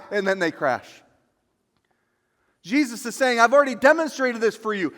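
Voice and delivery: loud, high fervent preaching; preaching at full force. Acted. Pastor to congregation, fervent loud preaching. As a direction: preach it out with fire.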